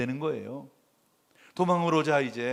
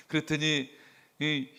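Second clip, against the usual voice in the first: ordinary talk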